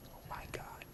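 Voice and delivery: barely audible, voiceless whisper; hushed, whispered swoon; whispered awe. Real, not acted. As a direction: whisper it, swooning over the food. Swooning over a stuffing recipe.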